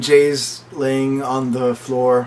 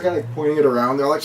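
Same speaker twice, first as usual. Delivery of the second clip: usual speaking voice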